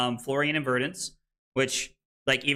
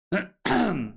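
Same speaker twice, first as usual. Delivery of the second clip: throat clear; 7 semitones higher than usual. Real, not acted. Throat clearing, something stuck.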